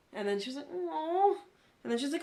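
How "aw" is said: high, drawn imitation of a let-down friend; mock a friend's higher, louder "aw"; disappointed aw. Retelling her friend's plea after refusing the dungeon, mock disappointed.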